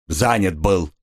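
low, glum short answer; sullen. A narrator's reading. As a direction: answer sullenly, pitch dropped. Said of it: "угрюмо ответил рыжеволосый". (угрюмо, низким голосом)

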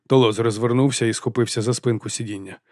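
ordinary delivery

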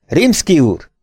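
sharp, high-pitched call; stern shout. A narrator's reading. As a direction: call him sternly to order. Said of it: "kiáltotta szigorúan". (szigorú kiáltás)

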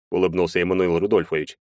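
ordinary delivery

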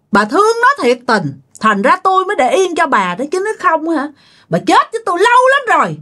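acted, rising-pitched bitter tirade; scolding. Wronged daughter-in-law tears into her mother-in-law, angrily scolding. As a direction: berate her, pitch jumping up on the threats. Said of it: "Đầu tiên á là chửi bà má chồng".

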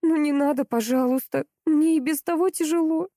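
high, tearful plea into the phone; crying on the phone. Acted. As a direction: beg through tears. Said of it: "Женя уже рыдала в трубку". (плачущий голос в трубку)

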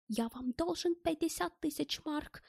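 high, tentative German voice; half question, half statement. A narrator's reading. Relieved German checks what he owes, half question, half statement. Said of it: "не то вопросительно, не то утверждающе".

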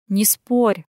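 firm interruption; mildly stern order. A narrator's reading. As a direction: cut in strictly. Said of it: "строго перебила она".

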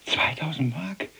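ungläubig gehauchte Frage; fassungslos geflüstert. Acted. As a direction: stockend und ungläubig flüstern. Ungläubiges Flüstern über das Gehalt. (erstauntes Flüstern)

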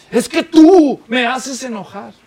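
queja teatral, aguda, fuerte y lenta; reproche airado. Acted. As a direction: echa la culpa alargando el reclamo. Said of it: "la gente le echa la culpa". (reproche enojado exagerado)

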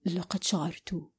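soft, low and tender; warm voice. A narrator's reading. He begins to explain why he cried, warm and soft. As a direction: say it gently, almost breathed. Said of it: "بصوته الدافئ".

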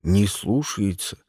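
slow, low-pitched grumble; complaining. A narrator's reading. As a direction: complain helplessly to the boss. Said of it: "пожаловался Карл". (жалуется низким голосом)

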